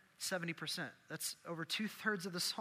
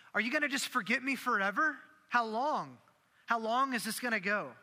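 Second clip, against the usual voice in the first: higher, louder, exasperated demand; David, angry; 5 semitones higher, 5 dB louder than usual. Acted. Preacher paraphrases David challenging God, angry demand. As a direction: push each question out hard and high, fed up. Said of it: "the anger in David's voice".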